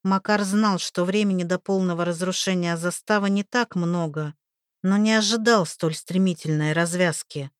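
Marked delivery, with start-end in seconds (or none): none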